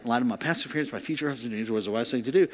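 low, soft recital to oneself; remembered inner voice. Retold or imitated. Preacher hearing the saying in his head, soft inner voice. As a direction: replay it softly, low in pitch. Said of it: "I could hear that".

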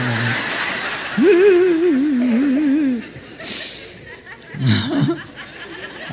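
long, high, breaking laugh; tearful laugh. Real, not acted. Pastor laughs at being so hormonal, high tearful laugh. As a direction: laugh helplessly at your own tears.

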